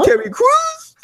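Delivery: very high-pitched mock squeal; hammed-up falsetto excitement; excited fan squeal. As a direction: squeal the name like a thrilled fan. Voices fan's excited reaction to reveal.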